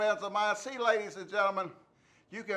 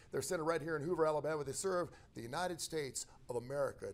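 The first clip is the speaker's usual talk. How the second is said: lower, softer ad-read voice; sponsor ad read; 4 semitones lower, 7 dB quieter than usual. Acted. Radio host reading sponsor ads to listeners. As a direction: roll through the sponsor spots.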